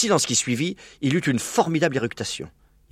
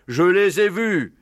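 very slow, higher character voice; agreeing badger; 7 semitones higher, slower than usual. Blaireau backing up the fox's claim, agreeing. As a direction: draw it out as a badger. Blaireau confirms what he saw.

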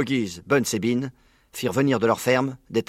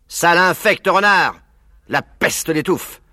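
loud, high, slow angry outburst; raging farmer yelling insults; furious curse; 7 semitones higher, 7 dB louder, slower than usual. Acted. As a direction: spit the curse at the fox. Boggs cursing the fox.

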